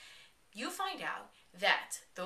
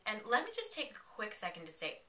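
usual speaking voice

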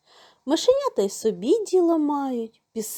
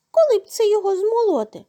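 high, fast squeaky mouse; little mouse voice; 10 semitones higher, 5 dB louder, faster than usual. A narrator's reading. Mice wonder how to grind the grain, high squeak.

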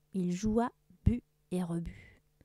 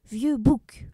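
louder, gruff pirate snarl; pirate threat; 4 semitones higher, 7 dB louder than usual. Acted. Blackbeard threatens his prisoner teacher, loud. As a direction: bark the insult at the old teacher.

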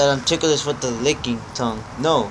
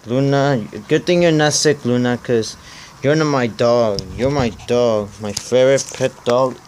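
ordinary talk

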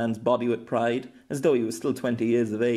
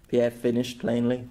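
ordinary delivery, nothing marked

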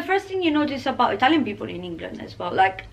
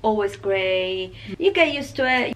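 ordinary delivery

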